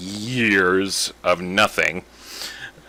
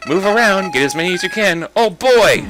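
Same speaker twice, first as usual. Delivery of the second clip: higher, louder excited flower; egging the player on gleefully; excited Flowey; 8 semitones higher, 5 dB louder than usual. Acted. Flower urging the player to catch pellets, excited. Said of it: "my superb voice acting".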